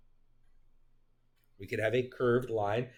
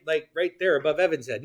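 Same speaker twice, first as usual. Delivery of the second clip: ordinary delivery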